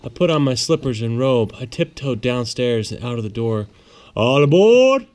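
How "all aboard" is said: loud, higher call of all aboard; conductor's call. A narrator's reading. Conductor calling children onto the train, loud and high. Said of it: "the conductor cried out".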